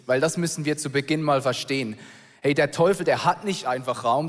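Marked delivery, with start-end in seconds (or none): none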